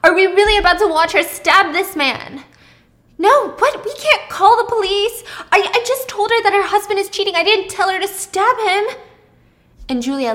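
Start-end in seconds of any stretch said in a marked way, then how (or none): 0.0-2.4 high, panicked yelling; screaming. Acted. Thomas watching Julia raise a knife, screaming in panic. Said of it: "and Thomas is screaming". 3.2-8.8 high, loud objection; panicked protest. Acted. Pippa refusing to call police on Julia, panicked and loud.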